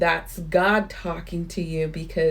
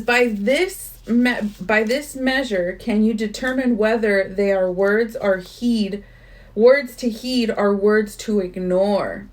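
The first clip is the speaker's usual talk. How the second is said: slow, louder solemn reading voice; earnest book reading; 4 semitones higher, 5 dB louder, slower than usual. Acted. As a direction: read it like scripture. Reads book passage aloud.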